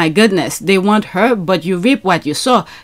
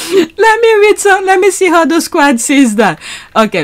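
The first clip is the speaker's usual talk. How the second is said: ordinary delivery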